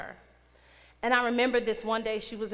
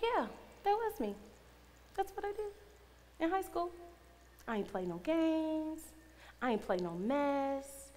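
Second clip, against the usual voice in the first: soft, higher-pitched, self-assured reply; retold cool confidence; 6 semitones higher, 5 dB quieter than usual. Owning a tough high school reputation, quietly self-assured. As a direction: own it quietly, stretching the last words.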